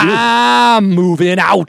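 slow, drawn-out joking declaration; deadpan pretend breakup news; lightly hammed-up joke; mock announcement. Joking about new roommates.

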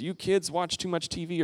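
a hint of an elder chiding kids; older generation scolding. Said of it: "the older generation says".

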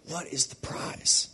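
slow breathy whisper, no voice; hushed whisper of the key question. Shown as a demonstration. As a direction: lean in and whisper it. Repeats the question as a hush.

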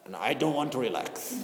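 low, soft mock refusal of someone who won't relax; resistant refusal. Angry visitor refusing to breathe: mild mock stubborn refusal. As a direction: imitate a stubborn person pushing back. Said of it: "there is a big resistance to do it".

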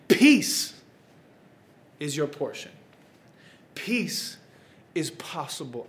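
slow, earnest preacher's call; preaching. Acted. Preacher to anxious viewers, slow earnest call. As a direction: lay it on their hearts, slowly.

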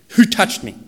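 loud, high call of Jesus. Acted. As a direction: call out sharply, demanding who touched him. Jesus voiced in retold story.

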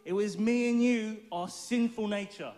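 ordinary talk, nothing marked

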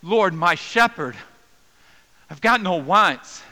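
loud, slow, high cry out to God; vocative cry. Shown as a demonstration. Preacher voicing the anguished psalmist, loud slow cry to God. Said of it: "in that vocative voice".

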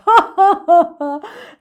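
high, very loud villain laughter; big hammed-up villain laugh; gloating laugh. A narrator's reading. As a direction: burst into wicked cackling. Gloating crocodile laughs aloud.

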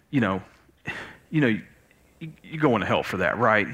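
a hint of a buddy breaking bad news; casual fake-friendly buddy. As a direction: slide in with a friendly warning. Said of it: "It's kinda like".

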